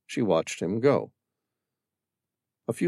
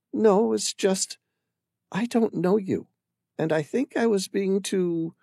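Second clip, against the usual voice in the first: higher woman's voice, halting; hesitant Amira; 11 semitones higher than usual. Acted. Amira to the stranger, hesitant and unsure. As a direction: grope for the words.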